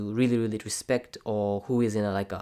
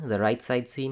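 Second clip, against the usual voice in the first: usual speaking voice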